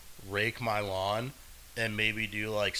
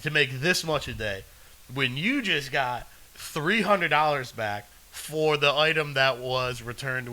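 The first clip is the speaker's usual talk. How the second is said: ordinary delivery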